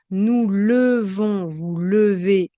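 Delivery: "levons" and "levez" with first e as eu; schwa E sound. Shown as a demonstration. Teacher drills lever for learners, eu sound in "levons", "levez". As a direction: stretch "levons", "levez" firmly as leu-von, leu-vé. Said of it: "le son est E".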